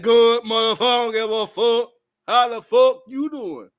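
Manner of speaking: loud, scornful shout back at the friend; retold angry retort. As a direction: yell it back at the friend who only texts for help. Retorting to a friend asking for help.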